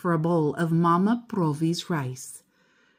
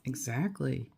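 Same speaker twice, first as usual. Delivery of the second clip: a soft whispered agreement; 6 semitones lower, 14 dB quieter than usual. Real, not acted. Storyteller whispering agreement to the listening child. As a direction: whisper it back conspiratorially.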